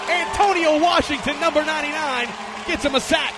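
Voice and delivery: rising to a shouted sack call; excited call. Real, not acted. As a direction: build to a big shout on the sack.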